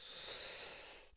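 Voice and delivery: long, soft, breathy sigh like a weary coffee machine; exasperated machine sigh. Retold or imitated. Imitating his coffee machine for viewers, exasperated sigh. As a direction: mimic the coffee machine giving up. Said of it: "it's just sighing and completely exasperated".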